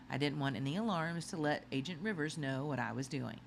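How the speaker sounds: ordinary delivery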